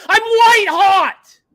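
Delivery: full-throated boast at the peak; triumphant shout. Real, not acted. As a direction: bellow it like a victory cry. Repeats brag as huge shout.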